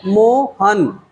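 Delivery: मोहन slowly split, mo... han; slow two-part मोहन with a gap after mo; syllable by syllable. Shown as a demonstration. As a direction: stretch मोहन into two separate beats. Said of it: "उन शब्दों की spelling बना लेंगे".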